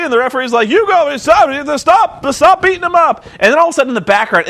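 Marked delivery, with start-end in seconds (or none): 0.7-3.2 high, frantic mock referee yell. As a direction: imitate a referee yelling orders. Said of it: "he's yelling at A J".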